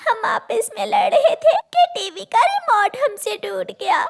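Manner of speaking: put-on high, weepy child explaining; a hint of crying; girl confessing to mother; tearful girl. Girl explaining through tears.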